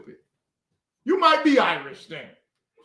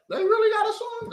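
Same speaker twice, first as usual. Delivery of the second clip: incredulous mock question; 13 semitones higher, faster than usual. Mock disbelief at the song.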